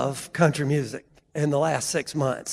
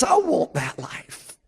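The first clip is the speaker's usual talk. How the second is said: slow, higher, tearful mock sob; imitated bawling; 5 semitones higher, 4 dB quieter, much slower than usual. Son telling dad what he wants, imitated tearful voice. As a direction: drag it out as if crying through it. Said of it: "he's just bawling".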